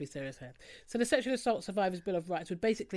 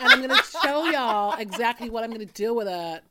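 louder, laughing vlogger voice; mock YouTuber; 9 dB louder than usual. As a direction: hype up the makeup like a YouTuber. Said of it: "you sound like one of the American YouTubers".

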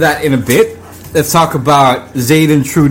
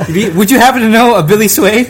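asked through laughter, pitched higher; laughing question; 7 semitones higher than usual. Real, not acted. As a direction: tease him while laughing at the joke. Teasing guest about Billy Swain.

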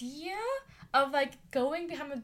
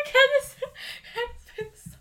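laughing; 12 semitones higher, 12 dB louder, faster than usual. Real, not acted.

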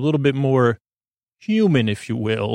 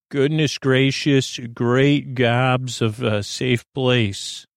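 slow mock exclamation; much slower than usual. Real, not acted. Rambling comic exclamation, slowed.

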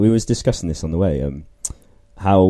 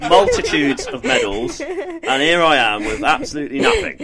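laughing; 9 semitones higher than usual. Acted. Self-pitying joke about medals.

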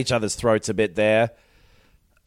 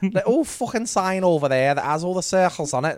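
put-on Liverpool-ish accent; 9 semitones higher than usual. Arya griping about her dad, mock Liverpool accent. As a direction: ham up a northern English girl. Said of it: "not a Mancunian accent that I'm doing".

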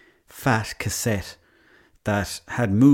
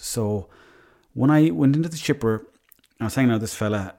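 ordinary delivery

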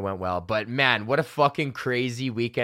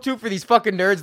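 ordinary talk

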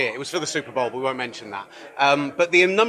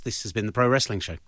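ordinary delivery

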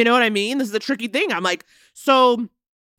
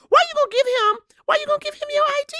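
much higher, incredulous demand at the screen; real frustration, pitched way up and rushed; exasperated outrage. Real, not acted. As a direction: fire the question off, livid and disbelieving. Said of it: "I was livid".

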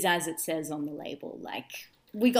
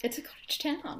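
voiceless, higher whisper of "It's a cottage town."; whispered echo; 9 semitones higher than usual. Real, not acted. Echoing her co-host in a whisper.

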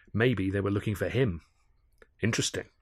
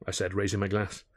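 ordinary delivery, nothing marked

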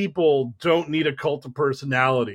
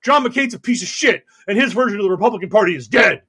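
mock furious rant; 4 semitones higher than usual. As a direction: tell the crowd off like a raging candidate. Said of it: "she was like".

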